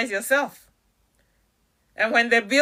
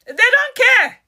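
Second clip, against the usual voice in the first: "They don't care" jumps up high and louder, punching "care"; sharp outburst; 11 semitones higher, 5 dB louder than usual. Real, not acted. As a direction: snap the truth at them. Blunt retort after the mock excuses.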